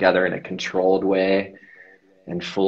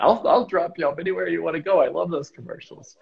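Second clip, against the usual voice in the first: lightly voiced eager fan; 10 semitones higher than usual. Starstruck driver offering a ride.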